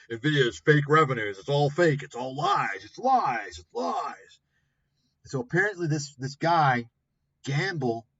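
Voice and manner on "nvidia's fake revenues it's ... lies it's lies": hammed-up shouting, louder, of a scammer's claims; mock the conspiracy guy by yelling his claims back; mock ranting claims. Mocking the Nvidia scammers with a hammed-up shout. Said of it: "making all these bullshit claims".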